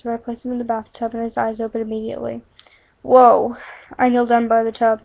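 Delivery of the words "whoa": loud startled whoa. Acted. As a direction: jolt awake with a yelp. Woken by hot bath water.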